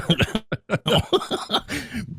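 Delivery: squeaky giggle at own joke; high laugh. Real, not acted.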